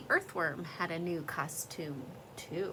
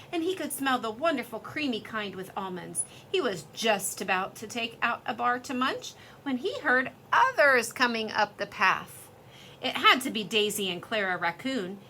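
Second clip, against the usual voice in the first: ordinary delivery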